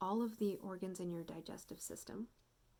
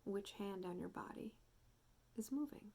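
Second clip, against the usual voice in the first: soft meditation voice with long pauses; guided meditation; 2 semitones higher, 8 dB quieter than usual. Real, not acted. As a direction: gently guide listeners into noticing their breath, unhurried. Guides a breathing check-in.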